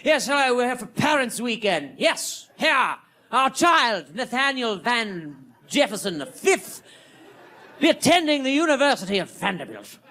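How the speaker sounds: high, lightly posh voice; posh couple. Retold or imitated. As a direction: put on a snooty rich parent. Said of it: "They're like".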